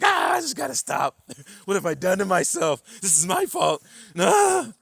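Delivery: very high, loud wailing outburst; acted anguish. Acting someone laying there in suffering, anguished loud cries. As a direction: wail it loudly, like someone cracking under suffering. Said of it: "You start getting mad".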